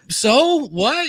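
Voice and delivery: high-pitched, puzzled retort; mock bafflement. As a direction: act out not getting the fuss. Acted baffled reply to praise.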